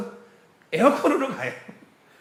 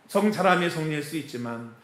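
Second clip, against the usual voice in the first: normal talking voice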